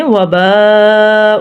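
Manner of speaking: "وباء" with aa and oo held long; lengthened madd. Shown as a demonstration. Teacher showing madd, lengthened vowels. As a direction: stretch both vowels of "وباء". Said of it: "دونوں کو لمبا کیا ہے".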